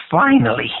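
put-on high, squeaky old man; squeaking, trembling voice. A narrator's reading. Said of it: "he said in a squeaking, trembling voice".

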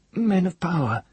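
high, eerie half-mad voice; a broken man stating a dark truth; crazed old wizard. A narrator's reading. Hare's voice.

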